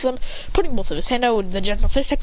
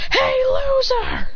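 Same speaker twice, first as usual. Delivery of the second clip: very high, drawn-out mock yell; Sasuke; much slower than usual. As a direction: holler it as a long jeering taunt. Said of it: "your boy Sasuke yells".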